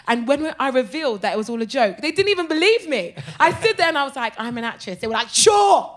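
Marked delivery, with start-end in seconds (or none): 4.4-5.0 soft, understated admission; low-key reveal. Retold or imitated. First reveal, calm and low-key. 5.3-5.9 loud, high, sarcastic retort; scoffing disbelief. Retold or imitated.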